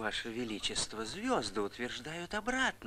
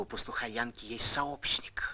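secretive whisper to the king. Acted. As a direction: whisper it confidentially. Courtier reports secret to king.

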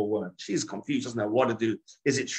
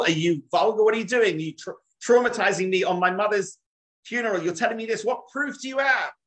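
higher, louder, indignant questions fired at the aunt; outraged disbelief; 8 semitones higher, 4 dB louder than usual. Acted. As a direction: play her shock and anger at the funeral. Daughter confronts aunt at funeral.

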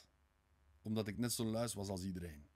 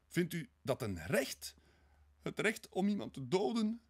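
high-pitched, louder anguished questions; wailing; 9 semitones higher, 5 dB louder than usual. A narrator's reading. Sonja challenges his right to kill, wailing. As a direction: wail it in despair at what he claims. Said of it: "Jammerde Sonja".

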